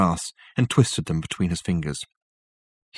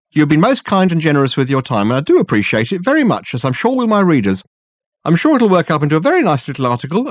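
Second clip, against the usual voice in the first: much higher and louder, effusive and gushing; a theatrical gentleman bowing his way out; Dirk's voice; 6 semitones higher, 5 dB louder than usual. Acted. Dirk takes his leave effusively.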